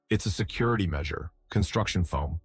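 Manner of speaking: ordinary delivery, nothing marked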